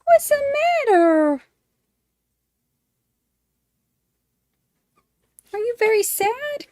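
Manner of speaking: high-pitched cooing at the puppy; sing-song pet voice. Real, not acted. Baby talk to dog.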